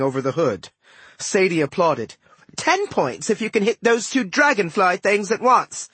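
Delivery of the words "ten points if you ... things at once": put-on high, excited girl's voice; excited calling out. A narrator's reading. Sister cheers on the driver's demon game, excited high call. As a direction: raise the stakes in a high, eager call. Sister shouting out next target.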